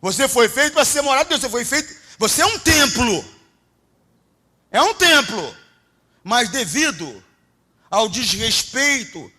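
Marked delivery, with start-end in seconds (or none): 0.0-5.6 loud, high-pitched preaching at full force; fiery preaching. Acted. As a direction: preach it hard to the congregation.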